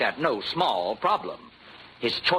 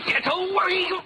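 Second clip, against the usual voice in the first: put-on boy shouting during the scuffle; desperate shout. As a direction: push him off. Boy fights back.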